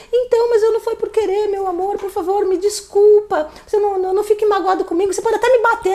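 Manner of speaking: put-on high, pleading voice of a begging girlfriend; fake desperate pleading. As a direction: beg him high and breathless, putting it on to calm him. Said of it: "Eu ainda falava desse jeito".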